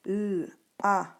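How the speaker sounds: "l, a" sounded separately, lll then a. Shown as a demonstration. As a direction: hold the l, then say a, in "l, a". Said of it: "le mot".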